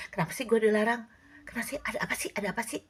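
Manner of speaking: a hint of a nosy teen whining. Forbidden person whining to know why, nosy. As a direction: pester like a kid who was told no.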